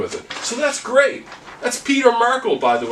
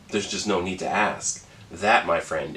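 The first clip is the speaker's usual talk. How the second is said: usual speaking voice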